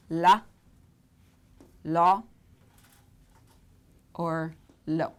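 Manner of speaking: la, la said loud, then lo; syllable demo. Shown as a demonstration. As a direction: sound out la, la, lo clearly. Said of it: "That's the way you pronounce it".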